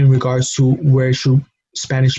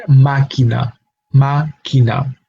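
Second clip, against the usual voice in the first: máquina stressed on its first syllable, MÁ-quina; third-to-last-syllable stress. Shown as a demonstration. Teacher gives the last example, máquina stressed as MÁ-quina. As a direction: hit the opening syllable of máquina, second take slow. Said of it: "the emphasis of the word falls in".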